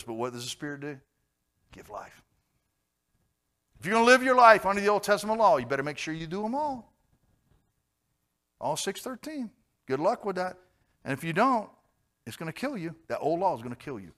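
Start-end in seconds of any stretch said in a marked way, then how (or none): 3.8-14.1 loud, high, driving sermon voice; preaching at full force. Acted. Preacher warning the church about the old law, loud and driving. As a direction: thunder the warning at the congregation.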